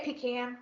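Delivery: "pecan" said PEE-can, with a long ee and a flat a as in can; pee-can pronunciation. Shown as a demonstration. Asking the co-host which way she says it, pee-can pronunciation. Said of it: "I would put the emphasis".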